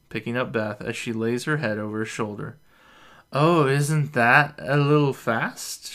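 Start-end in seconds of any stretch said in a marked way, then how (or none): 3.3-5.9 slow, loud tipsy surprise; drawn-out surprised tipsy question. A narrator's reading. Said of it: "she asks, surprised".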